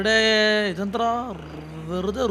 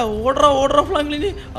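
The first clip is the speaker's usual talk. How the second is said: urging run; 7 semitones higher, 4 dB louder, much slower than usual. Real, not acted. Fleeing the granny in game.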